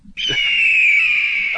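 shrill burst of laughter; high squealing laugh. Real, not acted. Laughing at own bird call.

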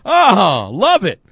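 delighted. Real, not acted. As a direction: exclaim it loud and pleased. Reacts to fan base message.

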